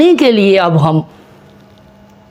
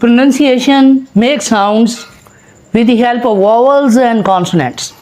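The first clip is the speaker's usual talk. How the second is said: slow dictation; much slower than usual. Shown as a demonstration. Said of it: "teacher dictating definition slowly".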